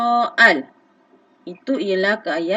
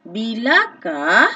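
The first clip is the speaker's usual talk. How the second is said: "Bilakah" slow and louder, falling; slow stressed demo; 5 dB louder, much slower than usual. Shown as a demonstration. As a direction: say "Bilakah" slowly, firmly. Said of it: "kata tanya yang sesuai ialah".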